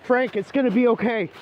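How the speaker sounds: calling out to Frank to reassure him. Acted. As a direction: shout comfort to a friend who has turned. Pleading with infected Frank.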